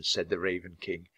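plain reading voice

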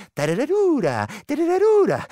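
high, loud ghostly trill rising to a shriek; shrieking creature call. Acted. The vrykolakas screams at the silent shepherd, high loud trill. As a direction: shriek it to terrify.

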